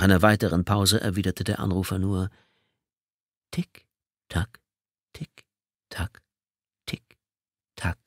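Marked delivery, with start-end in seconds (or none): 3.5-8.0 soft, fading sinister tick tock; taunting whisper-like tick tock. Acted. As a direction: let it trail away menacingly. Caller taunts before hanging up.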